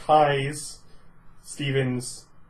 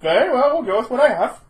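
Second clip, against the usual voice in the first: villain voice; 8 semitones higher, 5 dB louder, faster than usual. Acted. GM villain ends conversation.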